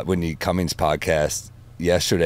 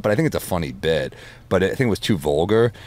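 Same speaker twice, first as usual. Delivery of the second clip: normal talking voice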